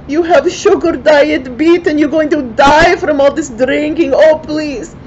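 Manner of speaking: high, loud, hammed-up wailing wife; fake worried yell. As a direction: yell like you're putting on a show for the neighbours. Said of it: "she yell as loud as she can".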